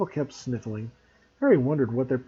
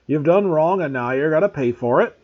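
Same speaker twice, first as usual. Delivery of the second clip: louder, stern Hagrid retort; fierce; 3 semitones higher, 7 dB louder than usual. A narrator's reading. Groundskeeper facing down a refusing pupil, fierce. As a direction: lay down the law fiercely.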